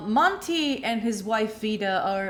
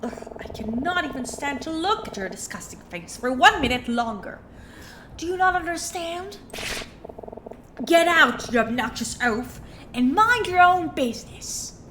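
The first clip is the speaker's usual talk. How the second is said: angry Jennifer Coolidge voice; 4 semitones higher than usual. Acted. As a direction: throw him out indignantly. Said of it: "[name] sound like Jennifer Coolidge".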